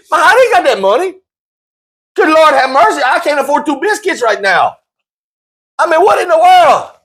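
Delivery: high, exasperated disbelief; incredulous. Real, not acted. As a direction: throw up your hands in disbelief. Incredulous rant about fan costs.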